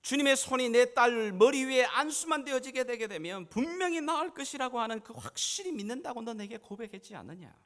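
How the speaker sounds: high, loud impassioned challenge; full-force preaching. Acted. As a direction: drive his own confession home at full voice. (높고 크게 외치는 설교)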